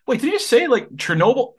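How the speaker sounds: high-pitched start, settling as the question lands; acted intrigued surprise. Someone hears their favourite topic mentioned, intrigued surprise. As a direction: voice someone's sudden, curious double take. Imitates someone's interest being triggered.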